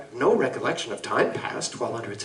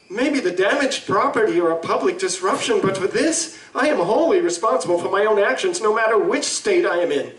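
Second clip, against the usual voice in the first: higher, louder, firm refusal; indignant; 6 semitones higher, 4 dB louder than usual. Acted. Doctor to the lawyer, refusing any excuse, raised and indignant. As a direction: stand your ground on your guilt.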